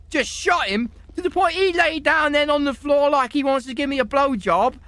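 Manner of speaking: normal talking voice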